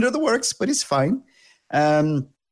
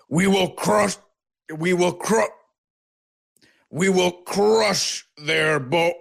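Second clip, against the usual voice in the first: high, strained war cry broken by hiccups; rally the clan but keep choking on hiccups; orc chieftain hiccuping; 6 semitones higher than usual. Acted. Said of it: "suddenly gets hiccups".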